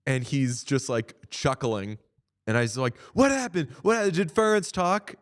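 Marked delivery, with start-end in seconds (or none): 3.1-5.2 high, eager retold questions; urgent excited asking. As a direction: ask like you just missed the big news. Rushing to ask reporter.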